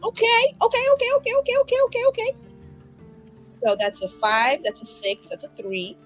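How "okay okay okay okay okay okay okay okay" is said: rapid high-pitched string of okays; squeaky okays. Real, not acted. Player psyching up to add the dice roll, squeaky. Nervous chatter before adding up roll.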